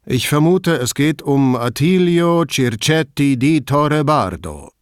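TV showmaster. Acted. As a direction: present the name like a game-show host. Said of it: "wie ein Showmaster im Fernsehen".

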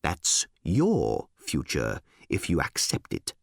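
low, very slow delivery; deliberate, weighty warning; ominous emphasis. Acted. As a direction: lean slowly on each word.

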